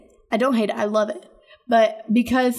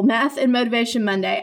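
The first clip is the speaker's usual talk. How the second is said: usual speaking voice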